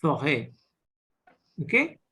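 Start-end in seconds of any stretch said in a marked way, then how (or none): 0.0-0.5 forêt with an open eh, silent s; French forêt. Shown as a demonstration. Teacher repeats forêt as the model. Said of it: "sounds like that".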